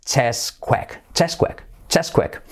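CHESSQUAKE as "CHESS-kwek", first slowly split; correct pronunciation. Shown as a demonstration. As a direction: drill CHESSQUAKE in two short syllables. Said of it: "Que sepáis que es".

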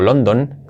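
"London" with full o in both syllables; as written. Shown as a demonstration. Teacher reading the written word, spelt-out London. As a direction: read "London" as spelt, Spanish vowels. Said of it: "nadie dice London".

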